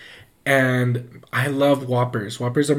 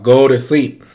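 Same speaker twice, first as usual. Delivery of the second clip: louder, firm bedtime order; retold parent's raised voice; scolding mom; 8 dB louder than usual. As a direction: order a child to bed, exasperated. Acts mother scolding at bedtime.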